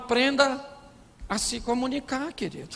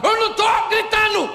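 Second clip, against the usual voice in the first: high, loud, hammed-up screaming; imitated yelling wife; 12 semitones higher, 6 dB louder than usual. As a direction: shout in outraged denial as the wife. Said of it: "como é que a senhora fala".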